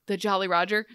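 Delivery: JOLLY stressed, ROGER rising as a question; startled question. Retold or imitated. Hook hearing of his ship, startled rising question. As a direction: echo the name in mock disbelief.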